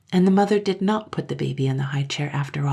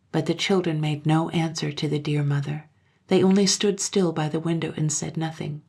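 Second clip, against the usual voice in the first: plain reading voice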